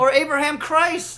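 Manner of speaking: high, loud exclamation; loud joke shout. Acted. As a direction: bellow it as a punchline. Escalating joke.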